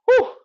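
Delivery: sharp exhaled whew; exerted whew. Real, not acted. Coach finishing ten reps on one leg, exerted whew. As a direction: blow out hard after effort.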